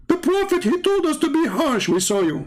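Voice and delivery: high, mocking caricature of a zealot; mocking impression. Mocking an opponent who preaches harshness, high caricature. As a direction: squeak it out like a ranting zealot.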